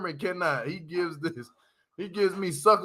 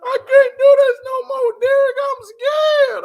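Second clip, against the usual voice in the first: high, loud, mock-scared wail; imitating panicked Monique; 9 dB louder than usual. Mimicking Monique to viewers, a hammed-up frightened high shout. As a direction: shriek it like a terrified passenger.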